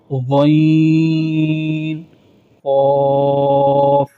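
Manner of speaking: خا صاد ضاد قاف drawn out, full and heavy; heavy letters. Shown as a demonstration. Teacher demonstrating the heavy letters, drawn out and full. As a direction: sustain each heavy letter like صاد and قاف long and thick. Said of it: "پر یعنی موٹے پڑھے جاتے ہیں".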